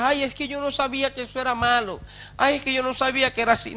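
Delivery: soft, fast whiny excuse, imitated; mock excuse. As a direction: rattle off a feeble excuse, mocking it. Mimics a believer's excuse. (imitando con burla una excusa rápida y suave)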